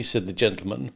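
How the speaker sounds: ordinary delivery, nothing marked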